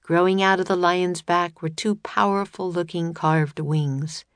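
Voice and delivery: ordinary delivery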